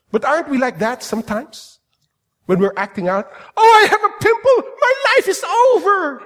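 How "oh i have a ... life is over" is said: put-on high, loud, whiny teen wail; hammed-up teenage despair, laughing through it; melodramatic twelve-year-old. Preacher mocking his twelve-year-old self over a pimple, whiny wail. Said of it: "my rendition of me when I was twelve".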